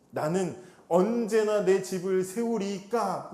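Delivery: slow, drawn-out lament; slow biblical quote. Retold or imitated. Jacob lamenting to his uncle, slow and drawn out. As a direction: stretch each word like old scripture. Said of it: "이게 그 얘기라 이 말이에요".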